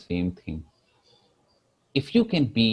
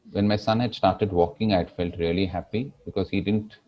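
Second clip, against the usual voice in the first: normal talking voice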